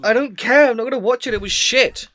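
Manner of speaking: high, loud, real exasperation; heated dismissal. Real, not acted. Friend refusing to watch part two, heated dismissal. Friends quarrel over a film.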